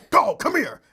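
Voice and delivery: high, loud mock order to a dog; imitated fussing. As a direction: imitate barking orders at a pet. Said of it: "you fussing at the dog".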